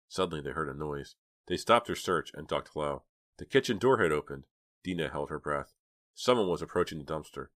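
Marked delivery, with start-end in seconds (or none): none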